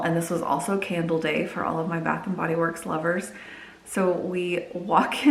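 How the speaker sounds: usual speaking voice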